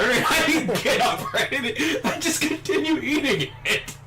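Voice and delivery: high, squeaky telling through laughter; laughing hard. Real, not acted. Confessing he stayed eating his salad, laughing hard. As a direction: barely get it out for laughing.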